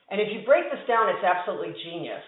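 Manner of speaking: ordinary talk, nothing marked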